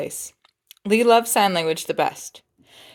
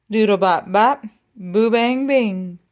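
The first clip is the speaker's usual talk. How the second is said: sax scat; 3 dB louder, slower than usual. Shown as a demonstration. Sax notes in story.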